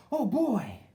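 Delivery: put-on high, loud eager "oh boy"; excited exclaim. A narrator's reading. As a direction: burst out with delight. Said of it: "thought Herbert".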